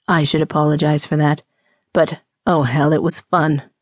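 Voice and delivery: low man's voice, amused mischief; unrepentant teasing rogue. Acted. Rogue to shocked woman, low and unrepentant.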